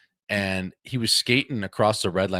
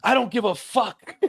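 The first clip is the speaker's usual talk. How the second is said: dismissive ref; 11 semitones higher, 3 dB louder than usual. Retold or imitated. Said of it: "He goes".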